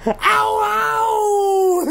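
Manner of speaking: high yelped ow; mock pained cry. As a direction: yelp like the hurt cat. Mimicking the cat's pain.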